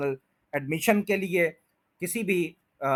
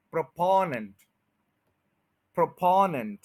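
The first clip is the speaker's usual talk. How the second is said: Proponent with पो stressed; stress on second syllable. Shown as a demonstration. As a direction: lean into पो in proponent. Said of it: "फॉ के ऊपर हो रहा है".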